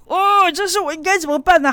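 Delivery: panicked mom pleading with herself; frantic mother. Retold or imitated. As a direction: play the mom at her wits' end. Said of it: "妈妈快疯了， 想说".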